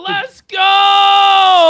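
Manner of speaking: loud, high "let's go" cheer; hype shout. Real, not acted.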